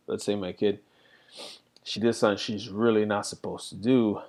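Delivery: normal talking voice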